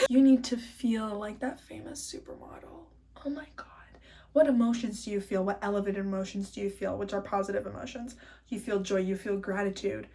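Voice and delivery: soft, breathy whisper to the camera; whispered guidance. Real, not acted. Vlogger coaching her viewers, in a whisper. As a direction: whisper it like a secret pep talk.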